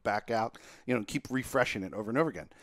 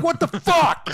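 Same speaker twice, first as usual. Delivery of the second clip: high, loud and incredulous; disbelieving yell; 8 dB louder, slower than usual. Retold or imitated. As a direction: shout at the screen that won't cooperate. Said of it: "I'm like".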